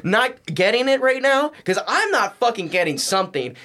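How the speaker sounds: exasperated disbelief. Retold or imitated. Retold exasperation mid-fight.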